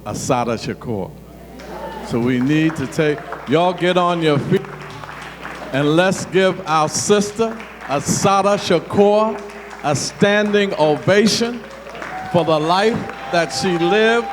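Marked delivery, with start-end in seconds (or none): 3.5-14.3 rising, slow rallying call to a crowd. Acted. Calls crowd to standing ovation.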